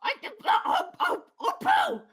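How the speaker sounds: very high Marge shriek; Marge Simpson voice. Acted.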